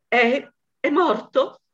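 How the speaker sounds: the wife asks the officers if he is dead, shaken; stammered exclamation. Acted. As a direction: stumble over the first word in feigned shock. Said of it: "esclamò lei".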